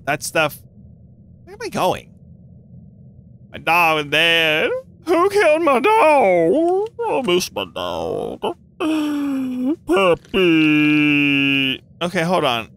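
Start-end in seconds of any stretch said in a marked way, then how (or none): 1.4-11.8 slow, wailing mock sob; mock grief. Crying to teammates that the dog was killed, mock sob. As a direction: ham up mourning the dead dog.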